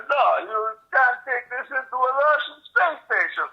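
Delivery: mock Russian accent. Tube staffer stopping him at the door, in a high mock Russian accent. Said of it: "they all in a Russian accent".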